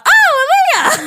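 high, loud, slow call of the name; a drawn-out delighted call; excited greeting. Retold or imitated. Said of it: "I did one of the".

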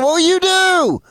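high, slow mock wife voice; quoting his wife as she firmly insists; wife's flat retort. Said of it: "But she said".

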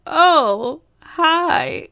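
high-pitched awkward hello, mildly acted; retold embarrassed greeting. She greets a follower without makeup, retold embarrassment. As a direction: act out being caught off guard. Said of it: "so embarrassed".

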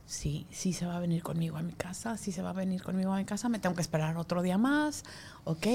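susurrado, casi sin voz, más suave; susúrraselo a la bebé recién cargada; susurro a la bebé. Retold or imitated. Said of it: "Y yo". (madre susurrándole a su bebé en brazos)

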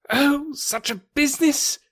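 high, distraught doctor's cry; anxious doctor crying out. Acted. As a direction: wail it out high like a panicked doctor. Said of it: "he cried".

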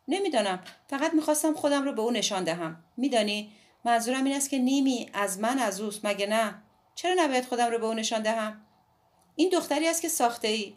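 put-on a defiant girl explaining herself; Midori's voice. A narrator's reading.

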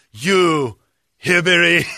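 loud, drawn-out imitation Korean accent; mock Korean accent. Angry man insulting dog owner, mock Korean accent. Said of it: "a Korean man with an accent".

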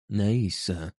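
ordinary delivery

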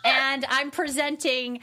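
slow, laughing starlet gush; drawn-out, giggly celebrity answer; gushing red carpet chatter. Pretend.

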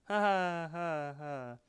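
high awkward forced chuckle; nervous fake laugh. Mordecai covering up to Margaret, nervous laugh. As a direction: cover guilt with a strained laugh. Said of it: "Mordecai's all like".

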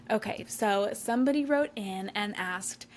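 usual speaking voice

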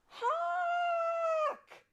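very high, loud drawn-out shout; alarm cry. A narrator's reading. Scout fairy warning the others, high shouted alarm. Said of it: "a scout fairy cried".

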